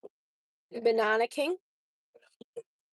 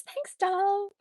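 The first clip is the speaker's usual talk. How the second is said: imitating the voice; 10 semitones higher, 4 dB louder than usual. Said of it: "He says".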